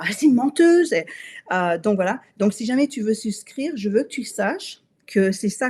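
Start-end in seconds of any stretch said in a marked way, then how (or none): 0.0-0.9 mock accusation. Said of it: "tu vas dire".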